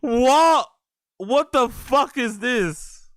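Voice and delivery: loud, high disbelief; incredulous outburst. Real, not acted. Reactor stunned by cheesy bragging, incredulous outburst. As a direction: burst out, stretching the question slowly.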